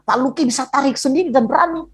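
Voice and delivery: high-pitched imitation of an astonished doctor; startled doctor. Doctor amazed the patient reset his own elbow, imitated in surprise. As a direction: voice jumps up in mock amazement. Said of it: "Sampai dokter itu kaget".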